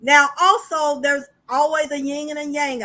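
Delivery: ordinary talk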